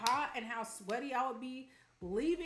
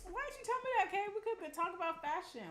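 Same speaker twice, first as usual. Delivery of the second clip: thrilled, voice jumping way up; excited squeal; 10 semitones higher, 3 dB louder than usual. Real, not acted. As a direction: gush over their news like a best friend. Excited about a viewer's fashion love.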